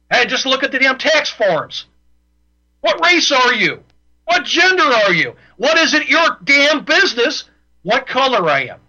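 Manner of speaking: getting riled up at the government; a radio host getting angry on air; worked up. Real, not acted. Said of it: "Damn, I'm getting worked up".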